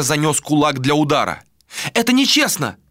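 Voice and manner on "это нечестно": high, indignant outcry; crying out. A narrator's reading. Malika as Ader raises his fist, crying out. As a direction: protest in a high cry. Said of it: "вскричала Малика".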